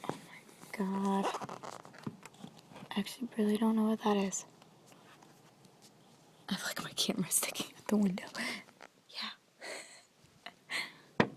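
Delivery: breathless hushed whisper; real frightened whisper. Real, not acted. Filming from the window at night, a frightened whisper. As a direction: whisper fast, rattled by the sound.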